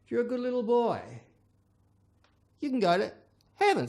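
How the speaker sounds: high, honeyed priest voice; a priest coaxing a good boy; sweet-talking priest. Acted. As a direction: promise heaven like a sugary salesman. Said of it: "they say".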